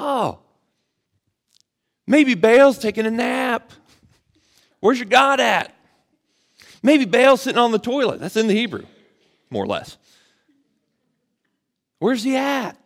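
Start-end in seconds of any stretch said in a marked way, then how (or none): 0.0-8.2 high, loud trash talk; taunting. Acted. Elijah mocking the prophets of Baal, loud high taunting. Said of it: "He's taunting. He's talking trash". 12.0-12.8 high-pitched mocking call; taunting. Elijah goading Baal's prophets about their god, high taunting. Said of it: "He's taunting. He's talking trash".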